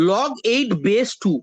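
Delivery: "log eight base two" spoken very slowly, word by word; correct reading order. Shown as a demonstration. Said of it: "উচ্চারণটা এইভাবে হবে".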